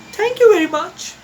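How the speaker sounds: high, bright thank-you; a polite child beaming; little elephant's voice. A narrator's reading. Elephant's child thanks the crocodile, high and polite. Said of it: "said the elephant's child".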